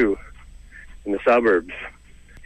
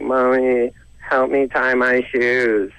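higher, slow whining-child imitation; hammed-up little kid, pitched up; Canadian kid; 4 semitones higher, much slower than usual. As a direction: drag it out as a mock Canadian child. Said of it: "A Canadian kid will be like".

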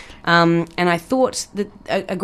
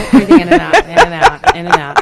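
laughing; 8 semitones higher, 7 dB louder than usual. Pretend. Mock yoga breathing chant.

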